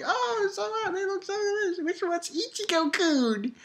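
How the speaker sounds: imitating a cooing hostess; mock girly voice. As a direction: coo like a fangirl. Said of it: "It's like".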